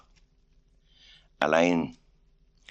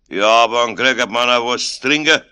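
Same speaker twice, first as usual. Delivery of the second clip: louder, higher, complaining Bavarian angel; grantiger Münchner; 6 semitones higher, 10 dB louder than usual. A narrator's reading. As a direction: demand a drink loudly in Munich dialect. Alois fordert zu trinken. (grantiger, lauter Münchner)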